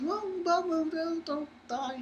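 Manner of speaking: high, soft, fading sing-song taunt; girl mocks the knocked-out dog, high and soft; mock girly taunt. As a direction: tease him in a high little voice. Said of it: "She's like".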